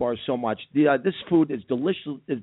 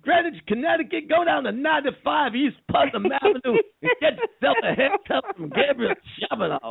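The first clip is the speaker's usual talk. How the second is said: high preacher voice; 11 semitones higher than usual. Acted. Reverend Al character ad read.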